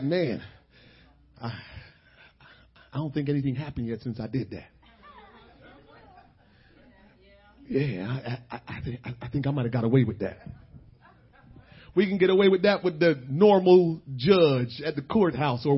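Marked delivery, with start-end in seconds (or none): 0.0-10.4 inner thought. Retold or imitated. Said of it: "we're like".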